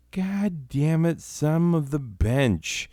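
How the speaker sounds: hammed-up frustrated moan; defeated groan. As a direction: drag it out in defeat.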